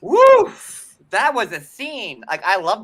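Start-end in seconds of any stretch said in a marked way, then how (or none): 0.0-0.5 very high, loud woo; whooping cheer. Real, not acted. Host whooping over a wild scene, very high and loud. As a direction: let out a big whoop.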